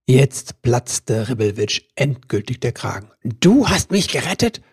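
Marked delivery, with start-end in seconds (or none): 3.2-4.6 indignant shout. A narrator's reading. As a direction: throw her words back at her. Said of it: "schrie er".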